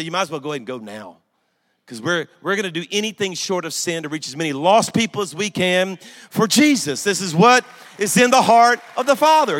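building preaching. Acted.

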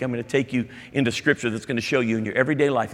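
ordinary talk, nothing marked

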